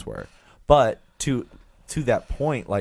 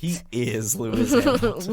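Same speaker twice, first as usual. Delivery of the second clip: mock dramatic; 11 semitones higher than usual. Echoing partner in mock awe.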